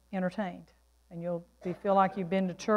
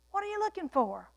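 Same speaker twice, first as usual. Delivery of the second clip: high-pitched, curious question; searching question; 11 semitones higher, faster than usual. Acted. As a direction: ask it again in a raised, wondering voice. Repeats Jesus' question, higher.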